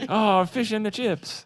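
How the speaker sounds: put-on slow, high English accent; hammy English accent. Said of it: "your English accent is outstanding".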